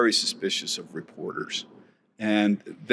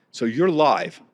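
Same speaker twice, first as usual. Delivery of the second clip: usual speaking voice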